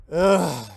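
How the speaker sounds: loud mock groan of disgust. Customer reacting to the handyman's pitch, loud disgusted groan. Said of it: "And I'm like".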